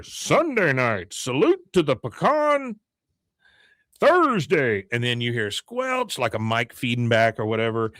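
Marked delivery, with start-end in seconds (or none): 0.0-4.8 slow, drawn-out announcer call; mock fairground figure's announcement; Big Tex. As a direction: announce it slowly, climbing high. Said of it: "from Big Tex".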